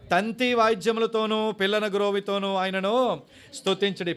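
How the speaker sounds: slow, loud, drawn-out psalm reading; solemn scripture reading. Acted. Preacher reading Psalm 150 aloud, slow and loud. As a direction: proclaim each phrase slowly.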